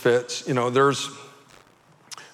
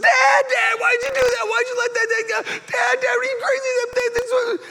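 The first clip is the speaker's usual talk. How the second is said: put-on very high squealed child voice; panicking kid; 3 dB louder than usual. Boy to dad who freed the bee, mock panic. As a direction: imitate a frantic little kid in a squeal.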